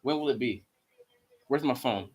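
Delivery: ordinary talk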